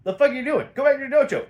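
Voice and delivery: mock bodyguard yelling at a kid; gruff bodyguards. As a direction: shoo the kid off like a bouncer. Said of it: "and they're like".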